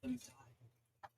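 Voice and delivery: very soft, faint plea with long pauses; drawn-out, hopeless near-whisper; hopeless dying child. Acted. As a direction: barely get the words out, as if giving up. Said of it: "hopeless look in his eye".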